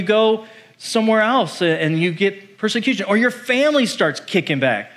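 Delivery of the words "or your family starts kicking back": ordinary talk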